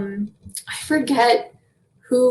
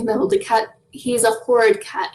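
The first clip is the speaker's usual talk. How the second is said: ordinary talk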